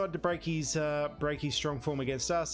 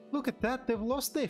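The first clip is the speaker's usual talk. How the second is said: amazed; 11 semitones higher than usual. Real, not acted. Real Madrid's heavy loss.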